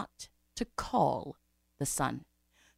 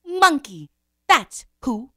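long hoot, then loud high verdict; hoot, pause, name the culprit; Mother Owl answering; 5 semitones higher, 9 dB louder, much slower than usual. A narrator's reading. Mother Owl names the culprit, hooting accusation. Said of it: "The owl said".

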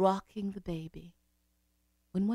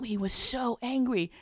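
usual speaking voice

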